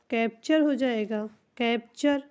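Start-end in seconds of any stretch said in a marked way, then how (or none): none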